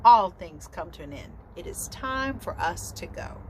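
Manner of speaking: plain reading voice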